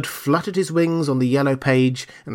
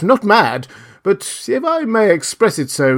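higher-pitched, scandalised official; shocked; 7 semitones higher than usual. A narrator's reading. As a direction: correct the young king in mild shock. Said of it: "Both the gentlemen were sincerely shocked".

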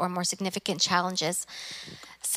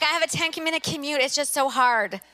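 mock whiny complaint; 6 semitones higher than usual. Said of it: "You're like".

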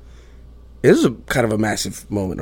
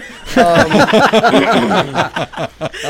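high embarrassed laugh; nervous laugh before a painful story; 9 semitones higher, 5 dB louder than usual. Real, not acted. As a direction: laugh off the nerves. Laughs off an awkward start.

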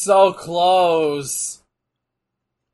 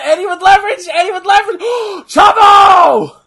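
much higher and louder cries of excitement; excited shouting; 11 semitones higher, 13 dB louder than usual. Real, not acted. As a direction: yell at the screen as the move lands. Excited live reaction to a match.

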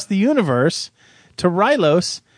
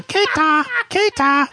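higher-pitched mock alien babble, repeated; alien gibberish; 12 semitones higher, slower than usual. Moon base aliens babbling at Alex, mock alien voice. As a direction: parrot the alien crowd's nonsense word. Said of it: "everyone's going".

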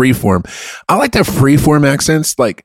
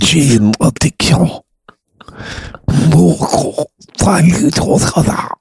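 put-on made-up Belgian accent, pitched higher; mock Belgian accent; 5 semitones higher, slower than usual. Comic passing off gibberish as Belgian, mock Belgian accent. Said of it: "the accent for Belgium".

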